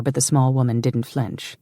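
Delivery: ordinary delivery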